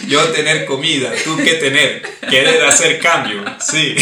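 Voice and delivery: broken caveman trading speech; caveman talk. Pretend. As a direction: bargain like a caveman, loud.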